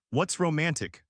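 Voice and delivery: usual speaking voice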